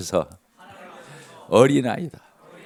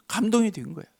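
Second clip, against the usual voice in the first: normal talking voice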